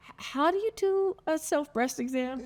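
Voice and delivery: high-pitched voice for a retold question; retold timid question. Asking a teammate on a late-night bus, high-pitched retold question.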